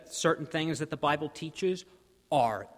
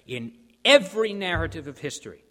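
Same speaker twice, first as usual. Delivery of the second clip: a preacher's loud call to the congregation; loud proclamation; 4 semitones higher, 8 dB louder than usual. Real, not acted. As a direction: ring out EVERY with full force. Preacher proclaims, then repeats softer.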